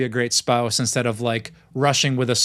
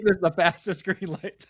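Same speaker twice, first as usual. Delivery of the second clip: words squeezed out through laughter; laughing; 6 semitones higher than usual. Real, not acted. To the host about drivers, laughing as he talks. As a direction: laugh your way through the line.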